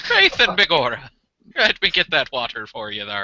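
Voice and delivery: hammed-up stage Irish, fetching the water; Irish accent. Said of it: "that's a pretty good Irish accent".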